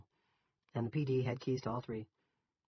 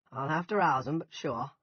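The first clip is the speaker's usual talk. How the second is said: higher-pitched Yankee farmer; obliging Yankee, pitched up; thick Yankee accent; 5 semitones higher, 8 dB louder than usual. A narrator's reading. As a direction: agree in a loud Yankee voice. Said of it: "In his thick Yankee accent".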